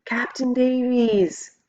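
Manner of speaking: slow, high call from far off; distant shout. A narrator's reading. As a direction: call out across the river. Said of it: "The shout wakes me".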